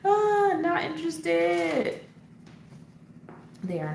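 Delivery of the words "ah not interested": drawn out and much higher, slow; a slow, high, sing-song mock complaint; mock dismay. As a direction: stretch it out in mock indignation. Repeats the phrase drawn out.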